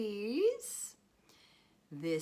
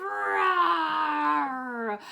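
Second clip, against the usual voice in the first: loud, long, high roar; big drawn-out beastly roar; lion roar; 5 semitones higher, 7 dB louder than usual. Acted. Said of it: "and it roars".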